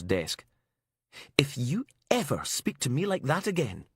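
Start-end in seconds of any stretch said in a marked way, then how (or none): 1.4-3.9 higher, indignant threat; acted outraged ultimatum; angry warning. A narrator's reading. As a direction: warn him off, incensed. Furious warning after storming in.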